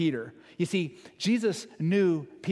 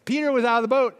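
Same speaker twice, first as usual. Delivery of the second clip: excited disciple; 6 semitones higher, 5 dB louder than usual. Retold or imitated.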